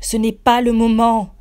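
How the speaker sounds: loud, high shout. A narrator's reading. Narrator shouting at golem Hicham. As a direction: yell it over the chaos. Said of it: "je crie".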